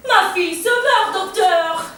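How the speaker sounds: high, loud melodramatic plea; hysterical mother. Acted. Mother rushing at the doctor over her daughter, hysterical and loud. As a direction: wail it at the doctor in crisis. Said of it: "Pique une crise".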